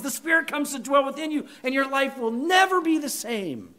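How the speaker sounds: ordinary talk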